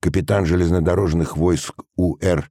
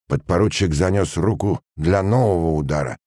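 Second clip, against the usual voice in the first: ordinary delivery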